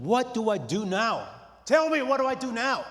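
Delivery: begging God for an answer at full voice; yelling at God. Retold or imitated. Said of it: "I'm yelling at God".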